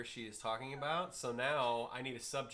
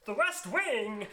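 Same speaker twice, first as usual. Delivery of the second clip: high, loud, drawn-out Target lady; chirpy SNL Target lady impression; 10 semitones higher, 11 dB louder, slower than usual. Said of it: "like the Target lady from S N L".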